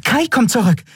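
high, piping little voice; small flying creature calling out news; announcing. A narrator's reading. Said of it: "verkündete sie".

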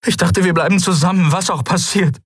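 high, quick, agitated plea; desperate. A narrator's reading. Kai pleading with his mentor's spirit, desperate. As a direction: plead desperately, voice pitched right up. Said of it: "Er fuhr sich verzweifelt durch das Haar".

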